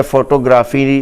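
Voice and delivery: ordinary talk, nothing marked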